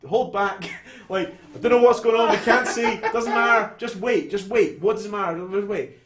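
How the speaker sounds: loud, high, urgent shouting over gunfire; shouted orders. Retold or imitated. Agent shouting at his team to hold back, loud and high. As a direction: yell it like the shooting is right outside.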